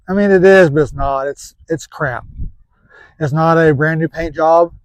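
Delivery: normal talking voice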